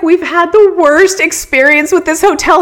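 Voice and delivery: high, loud rush of complaint; excited complaint. Retold or imitated. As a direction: spill your hotel woes eagerly.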